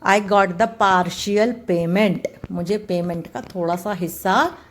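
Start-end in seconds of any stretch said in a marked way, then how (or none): 0.1-2.3 slow, careful example sentence. Shown as a demonstration. Teacher reading a partial example to students, slowly. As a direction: read each word out slowly. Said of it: "उसे हम कहते हैं partial".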